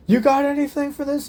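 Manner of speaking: high loud call; urgent call. Acted. Harry asking Rose for a weapon, urgent call. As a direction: shout over the fight for help.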